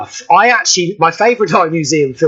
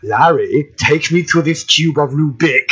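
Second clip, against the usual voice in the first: low-starting horror villain impression; old film villain, dropping low on the name; Boris Karloff; 3 semitones lower than usual. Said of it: "in a Boris Karloff impression".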